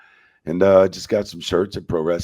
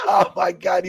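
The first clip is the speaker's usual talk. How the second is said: exasperated; 12 semitones higher, 5 dB louder than usual. Retold or imitated. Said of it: "I'm like".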